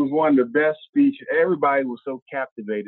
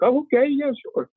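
caught-off-guard agreement; 14 semitones higher than usual. Retold or imitated. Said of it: "And she's like".

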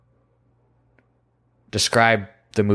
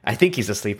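normal talking voice

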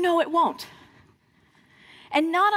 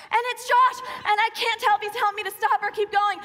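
ordinary talk

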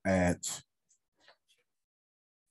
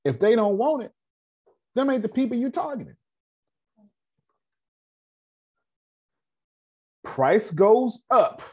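firm insistence; 11 semitones higher than usual. Real, not acted.

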